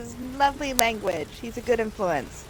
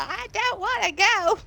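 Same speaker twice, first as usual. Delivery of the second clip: whiny protest; 10 semitones higher than usual. Pretend. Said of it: "kicking and screaming".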